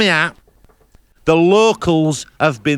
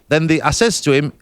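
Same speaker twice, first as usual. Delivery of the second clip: ordinary delivery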